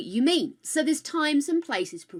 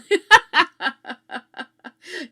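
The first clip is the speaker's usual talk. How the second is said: high loud laugh; 9 semitones higher, 10 dB louder than usual. Real, not acted. Laughing to chat about Tibbs getting blue back. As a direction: break into laughter.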